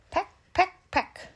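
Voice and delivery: high, clipped pecks; pecking sound. A narrator's reading. Narrator pecking high for the sparrow. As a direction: peck like a little bird. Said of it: "says the sparrow".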